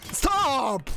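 high, drawn-out yell; exasperated shout. Real, not acted. Player begs opponent to let up, exasperated yell. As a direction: yell it out and stretch it.

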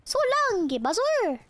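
high, swooping game-hero farewell; Mario. Acted. Showing a friend the Mario lines, high cartoon voice. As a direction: call it out high and drawn like a game mascot. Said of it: "And then he's like".

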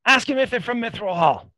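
high, loud shout from above. Acted. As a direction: yell it down from the balcony. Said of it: "his sons shouts down".

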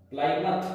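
"प्लायमाउथ" said "PLY-muth", second syllable reduced; correct pronunciation. Shown as a demonstration. Teacher modelling the name for students, "प्लायमाउथ" ending in short "muth". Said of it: "pronunciation अलग होता है".

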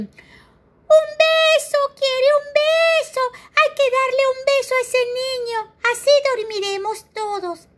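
put-on high, loud baby voice calling out; shouting. Baby elephant shouts to the jungle animals, high and loud. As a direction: yell it so the whole jungle hears. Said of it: "grita Chiquitín".

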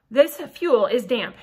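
plain reading voice

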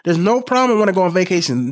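usual speaking voice

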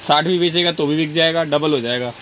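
ordinary delivery

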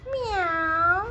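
high, drawn-out playful meow; cat meow. Pretend. Mother showing baby the cat, playful meowing.

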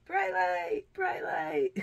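high, loud repeated cry; startled exclamation. Real, not acted. Reacting to switching the bright light on, startled exclamation.

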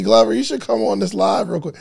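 ordinary talk, nothing marked

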